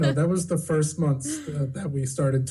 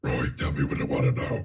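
much lower, menacing villain growl; Doctor Claw voice; 14 semitones lower than usual. Retold or imitated. Imitating his wife's Doctor Claw voice for the listeners. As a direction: growl it like a cartoon supervillain. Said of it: "she's got a really great Doctor Claw voice".

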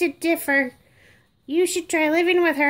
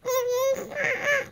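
fake baby wail; 7 semitones higher, 5 dB quieter than usual. Doll's owner moving her.

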